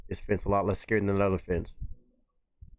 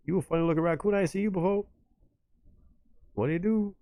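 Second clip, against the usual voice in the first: higher-pitched put-on animal voice; voiced animal chat-up; 8 semitones higher than usual. One animal sizing up a funny looking raccoon, in a high put-on voice. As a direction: lilt it high, voicing a curious animal.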